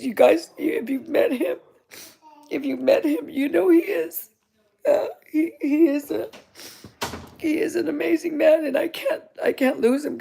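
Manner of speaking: fighting back real tears, trailing into a whisper; crying. Real, not acted. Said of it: "I'm crying and all this".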